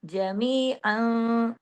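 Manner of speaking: very slow जमीअन, final n hidden in a held hum; ikhfa with ghunna. Shown as a demonstration. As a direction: draw out the nasal end of जमीअन. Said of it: "इख्फा हकीकी गुन्ना कामिला". (जमीअन में इख्फा और पूरा गुन्ना)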